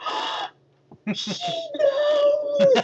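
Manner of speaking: high shrieking gasp; excited shriek. Retold or imitated. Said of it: "And we're like".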